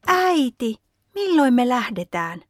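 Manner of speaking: lightly voiced boy calling out as he rushes in; eager child's call. A narrator's reading. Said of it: "ryntää sisään ja huutaa". (innokas lapsen huuto)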